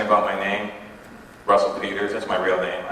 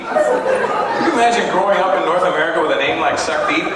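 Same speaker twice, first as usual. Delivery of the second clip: normal talking voice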